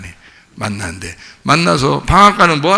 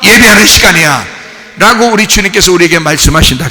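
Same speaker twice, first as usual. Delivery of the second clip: raised, loud sermon voice that keeps building; fervent preaching; 6 semitones higher, 6 dB louder than usual. Acted. Preacher rousing the congregation, loud and fervent. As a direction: preach it out to the whole church.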